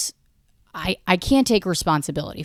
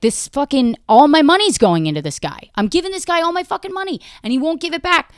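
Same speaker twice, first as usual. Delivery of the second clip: rising into a high, fast panicked rant; losing it; 9 semitones higher than usual. Retold or imitated. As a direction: freak out in the back seat, voice climbing. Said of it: "just losing my shit".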